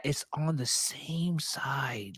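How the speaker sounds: conspiratorial whisper, slowed. Real, not acted. Telling co-hosts about the sculpt in a whisper. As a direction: lean in and breathe it like a secret.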